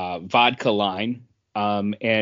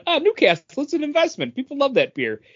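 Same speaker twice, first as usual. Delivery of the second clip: high, gushing investor voice; mock the eager buyers; 14 semitones higher, faster than usual. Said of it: "everyone keeps going".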